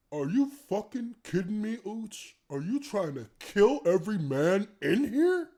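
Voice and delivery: a hint of real anger; mock outrage. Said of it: "He's like".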